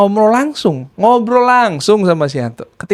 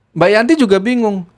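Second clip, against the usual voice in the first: usual speaking voice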